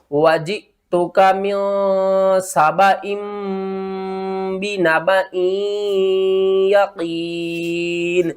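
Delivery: drawn-out tajweed ending; recitation. Shown as a demonstration. Teacher reciting, slow tajweed ending. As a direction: hold the ending long.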